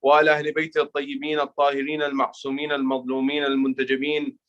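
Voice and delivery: brisk, low chanted Arabic recitation; recited Arabic invocation. Real, not acted. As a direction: rattle off the opening prayers in a low chant. Continuing opening Arabic invocation.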